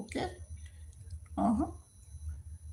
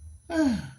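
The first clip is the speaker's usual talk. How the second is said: high, falling sigh; audible sigh; 7 semitones higher than usual. Real, not acted. Streamer up late counting down, falling sigh. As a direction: let out a sigh that drops in pitch.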